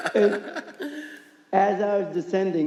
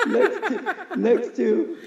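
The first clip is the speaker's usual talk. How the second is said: laughing; slower than usual. Real, not acted. Laughing at own image.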